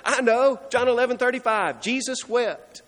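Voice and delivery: high, quick answer blurted out in class; eager pupil. Retold or imitated. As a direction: imitate a kid racing to answer first. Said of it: "we want to be the first one to say".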